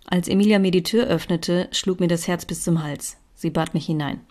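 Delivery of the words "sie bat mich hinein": ordinary delivery, nothing marked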